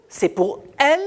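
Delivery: ordinary talk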